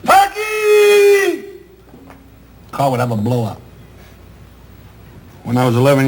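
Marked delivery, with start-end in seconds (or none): 0.1-1.4 imitating a mother yelling out the window; hammed-up mom screaming a kid's name; mother's yell. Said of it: "she would yell".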